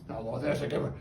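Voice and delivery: soft bird praise. Real, not acted.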